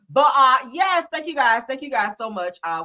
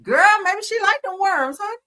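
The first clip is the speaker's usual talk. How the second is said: teasing; 11 semitones higher, 5 dB louder than usual. Real, not acted. Teasing after gross comment.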